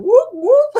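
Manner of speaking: high, loud whoop like a patrol call; imitating Scott chasing people down the halls; mock siren call. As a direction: call it out down the hallway. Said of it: "going up and down the hallways going".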